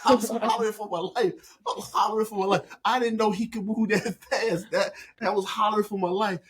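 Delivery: high-pitched telling through real laughter; amused, laughter-lifted storytelling; laughing retelling. Real, not acted. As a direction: tell it while cracking up. Laughing while telling of beating.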